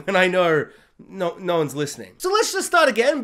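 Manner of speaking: usual speaking voice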